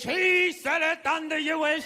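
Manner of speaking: drawn-out, put-on archaic church voice; hammed-up Old English pronouncement; mock Old English preacher. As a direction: intone it slowly like a pompous old-time cleric. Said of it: "speaking in Old English".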